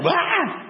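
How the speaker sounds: high startled shriek of a scared disciple; mock startled scream. Disciples seeing Jesus as a ghost, mock terrified shriek. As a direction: yelp like you just saw a ghost. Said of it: "They went into freak out mode".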